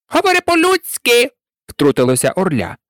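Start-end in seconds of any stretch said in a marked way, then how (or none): 0.1-1.3 sharp, high creature voice; interrupting eaglet. A narrator's reading. Eaglet interrupting the turkey's long speech, high protest. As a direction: cut in abruptly, pitched very high.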